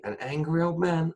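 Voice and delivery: high, disbelieving echo of her words; incredulous echo. Retold or imitated. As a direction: repeat it back, not believing it. Stunned by psychic's claim.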